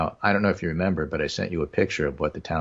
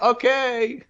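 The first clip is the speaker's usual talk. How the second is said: high-pitched answer called from inside; calling back; 3 dB louder than usual. Acted. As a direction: sing out a reply to someone out of sight. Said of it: "And somebody will say".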